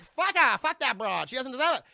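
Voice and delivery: loud, high-pitched mock outrage; angry outburst. Carl refuses to rescue Fay Wray, in a loud acted outburst.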